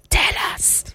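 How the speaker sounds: "Tell us." breathed in a soft whisper. Shown as a demonstration. Host coaxing listener's secret out in a whisper.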